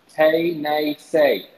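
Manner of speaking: Tay Nay Say, three slow separate syllables; Cherokee pronunciation. Shown as a demonstration. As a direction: spell out Tay Nay Say slowly, each ending in AY. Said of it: "in the Cherokee language, it is pronounced".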